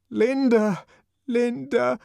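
very high, loud calling; child crying out. A narrator's reading. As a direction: scream for his mother. Child screams for mother.

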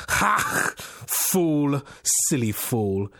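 explosive "Ha!", then scornful jeer; scoffing. A narrator's reading. Unseen voice jeers at the man for being logical, scoffing. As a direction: burst out high, then sink into contempt. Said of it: "The voice scoffed at anybody".

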